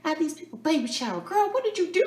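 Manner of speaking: high-pitched, incredulous mom impression; mock scolding mom. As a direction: scold a daughter in a squeaky put-on voice. Mother's scolding reply acted out.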